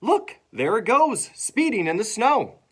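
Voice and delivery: loud, high excitement; excited calling out. Acted. Harry at the window to class, loud excited cry. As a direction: call out as if pointing at the sight.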